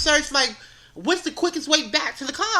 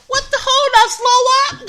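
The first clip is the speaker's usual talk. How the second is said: startled outburst; 11 semitones higher, 8 dB louder than usual. Retold or imitated. Card payment taking too long.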